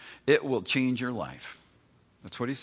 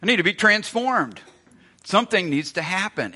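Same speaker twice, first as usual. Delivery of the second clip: higher, louder, pressing need; mock urgent plea; 8 semitones higher, 7 dB louder than usual. Preacher voicing the convicted listener, loud urgent plea. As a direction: cry out for change. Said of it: "made you think".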